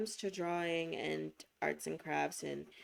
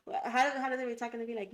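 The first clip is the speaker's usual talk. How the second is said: normal talking voice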